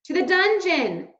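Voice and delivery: mice shouting. A narrator's reading. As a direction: shout him down to the dungeon. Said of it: "shouted the mice".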